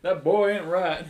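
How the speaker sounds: hammed-up cartoon Texan; loud Hank Hill quote. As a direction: declare it like a fed-up Texas dad.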